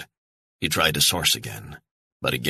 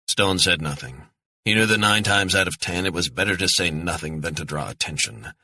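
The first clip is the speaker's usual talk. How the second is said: ordinary delivery, nothing marked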